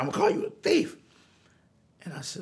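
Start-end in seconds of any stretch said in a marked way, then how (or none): 0.0-1.0 angry threat. Retold or imitated. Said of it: "He's like".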